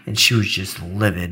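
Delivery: ordinary talk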